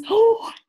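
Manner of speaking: sharp high loud gasp of shock; startled gasp. Acted. Cats see a mouse in the lunchbox, a loud shocked gasp. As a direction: gasp as if you just saw a mouse.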